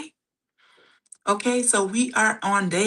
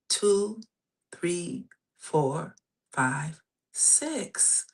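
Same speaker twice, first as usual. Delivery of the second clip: soft, unhurried breathing guide; guided breathing; 4 dB quieter than usual. Acted. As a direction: count the breaths gently and calmly. Leads a breathing exercise.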